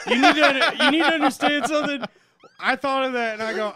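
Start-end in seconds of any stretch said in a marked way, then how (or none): 0.1-2.0 laughing. Real, not acted. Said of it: "laughing emotional plea".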